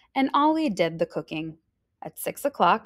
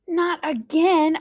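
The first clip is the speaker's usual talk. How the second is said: exasperated; 10 semitones higher, 5 dB louder, slower than usual. A narrator's reading. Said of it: "Ollie exclaimed".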